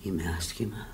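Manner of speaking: barely audible, drained of strength; faint weak admission. Acted. Sick woman to her friend, faint and weak. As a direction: let it fade out weakly. Dying woman admits illness.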